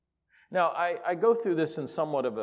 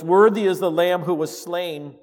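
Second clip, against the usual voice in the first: loud voice; 5 semitones higher, 9 dB louder than usual. Acted. Preacher reading the creatures' praise, loud proclamation. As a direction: proclaim it loudly like a heavenly choir. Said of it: "saying with a loud voice".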